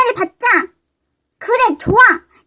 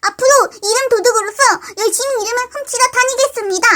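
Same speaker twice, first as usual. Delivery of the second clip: ordinary talk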